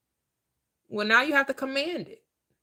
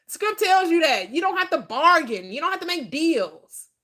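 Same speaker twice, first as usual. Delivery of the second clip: higher, louder preacher's drive; full-force preaching; 6 semitones higher, 4 dB louder than usual. Acted. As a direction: preach it hard to the congregation.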